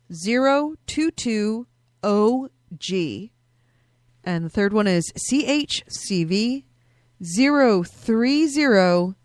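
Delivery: slow, spelled-out code reading; slow dictation. Shown as a demonstration. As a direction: read each letter slowly and clearly. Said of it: "Here are your codes".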